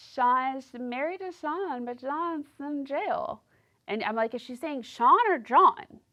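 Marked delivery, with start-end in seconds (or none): 0.1-3.4 higher, slow, mumbling; sloppy drawn-out mimic of Lacey; slurred Lacey voice. Imitating Lacey. 4.3-6.0 louder, higher, baffled; confused. Retold or imitated. Her confused question.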